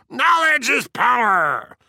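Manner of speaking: high, drawn-out shriek; mock shriek. A narrator's reading. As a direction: shriek it slowly, mimicking his outburst. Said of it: "And you shrieked".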